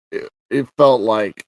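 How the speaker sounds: ordinary delivery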